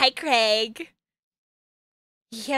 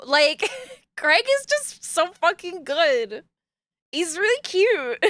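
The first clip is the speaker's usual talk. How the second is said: higher, slow, swooning gush; gushing crush; 6 semitones higher, slower than usual. Real, not acted. Streamer admitting a crush on Craig, gushing. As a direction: draw it out like a smitten fan. Gushing over favourite dating option.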